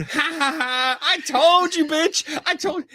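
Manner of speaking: very high, cackling told-you-so; hammed-up gloating old lady, laughing; shrieking mock triumph; imitating the cackling friend. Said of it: "her friend's going".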